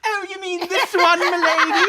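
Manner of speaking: high, hammed-up posh British voice; mock British butler, put on thick; British accent. Requested by friend, hammy high British accent. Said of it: "Do it right now".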